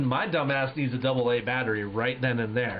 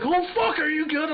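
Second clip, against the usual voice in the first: much higher, louder frantic cry; panicked yell; 14 semitones higher, 4 dB louder than usual. Retold or imitated. Teammate panicking after the shot, high frantic yell.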